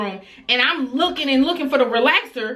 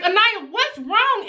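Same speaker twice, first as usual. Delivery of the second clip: alarmed; 11 semitones higher than usual. Retold or imitated. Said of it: "I'm like".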